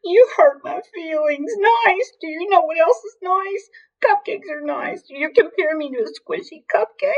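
hammed-up crying, very high-pitched; wailing cry. A narrator's reading. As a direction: whine it out as an offended, tearful hippo. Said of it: "she would cry".

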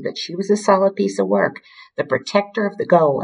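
usual speaking voice

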